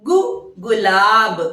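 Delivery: गु then gulab said slowly for the class; slow classroom enunciation; slow teaching demo. Shown as a demonstration. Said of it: "उच्चारण सही कीजिएगा".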